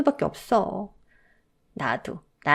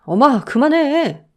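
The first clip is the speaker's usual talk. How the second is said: pleading plea to stop; 6 semitones higher, 4 dB louder, slower than usual. Acted. Husband stops his mother.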